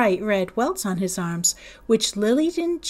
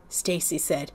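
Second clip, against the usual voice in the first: ordinary delivery, nothing marked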